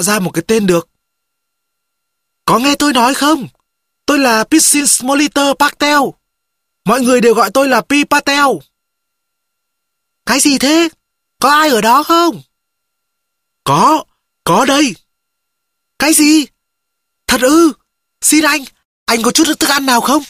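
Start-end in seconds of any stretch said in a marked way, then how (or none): 2.4-8.5 high-pitched, insistent call. A narrator's reading. Castaway tells the unseen voice his name, calling out high. 10.3-17.7 high-pitched, urgent calling voice; startled calling. A narrator's reading. Unseen stranger asks who is there, high startled call.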